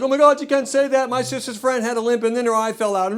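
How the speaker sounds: high-pitched, offended whine; mock outrage. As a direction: imitate an easily offended American protesting. Imitates easily offended Americans.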